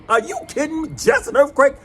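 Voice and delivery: high, disbelieving squeal; incredulous high echo. Retold or imitated. Man echoing the calm lady, high outraged disbelief. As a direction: throw her words back in disbelief.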